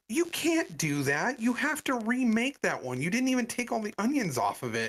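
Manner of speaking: high-pitched, insistent complaint; exasperated protest. Retold or imitated. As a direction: act out a fed-up customer laying down the rules. Customer argues over remade burger.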